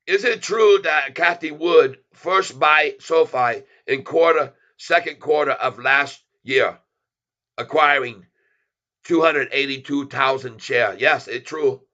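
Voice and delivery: a lightly put-on broken-English accent, playful; put-on foreign accent. Streamer hyping his channel in a put-on accent. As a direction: clip every word in a mock foreign accent. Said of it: "So we go back a voice".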